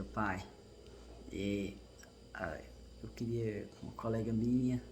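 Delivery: put-on shy little kid whispering to dad; softly, like a scared six-year-old; child's timid voice. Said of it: "daquele jeito que criança fala".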